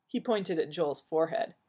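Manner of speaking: usual speaking voice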